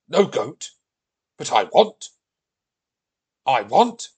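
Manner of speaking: loud, stubborn Viking protest; Viking tantrum. Acted. Viking told there is no goat, loudly whining. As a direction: protest like a thwarted giant toddler.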